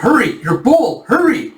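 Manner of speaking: high, urgent call; hammed-up pressing cry; mock urgent command. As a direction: urge him to hold out the bowl. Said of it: "tone of his voice".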